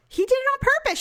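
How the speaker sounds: high-pitched emphatic accusation; retold indignant insistence. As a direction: act out pressing the point home. Retold insisting in family argument.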